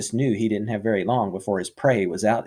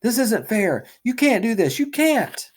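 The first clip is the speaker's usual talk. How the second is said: distressed protest; 12 semitones higher than usual. Acted. Boy protests, near tears.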